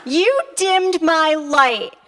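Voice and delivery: slow, high, loud mock hurt; hammed-up wounded accusation; melodramatic reproach. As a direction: draw it out like a wronged diva. Melodramatic reproach to husband on phone.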